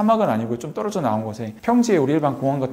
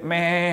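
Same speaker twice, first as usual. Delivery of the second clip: drawn-out imitated baa; long mimicked "meee" of a lamb; sheep bleat. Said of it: "양 소리가".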